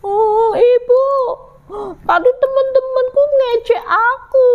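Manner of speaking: high, whiny pretend crying; tearful. Ciki tells mother about teasing, tearful high whine. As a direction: wail the complaint like an upset child.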